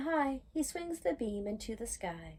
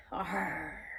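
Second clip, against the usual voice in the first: low playful growl; mock engine growl; 5 semitones lower than usual. Reader voicing the excavator at work, low growl. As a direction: grunt like a digger.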